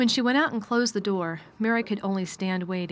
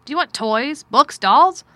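louder curt master asking; gruff man voice; 6 semitones higher, 12 dB louder, slower than usual. Acted. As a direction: ask it with cool distance. Mr Craven asks.

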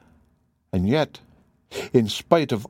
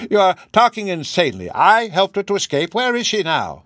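raised, higher protest; cry; 8 semitones higher, 4 dB louder than usual. A narrator's reading. Professor still crying out his denial at Holmes. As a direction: keep shouting the denial. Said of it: "he cried".

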